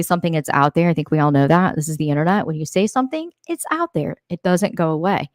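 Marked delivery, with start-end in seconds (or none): 2.5-4.2 lifted up high and hushed; high, hushed repeat for emphasis; higher, softer emphasis. Shown as a demonstration. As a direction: drive the point home, pitched up and softer.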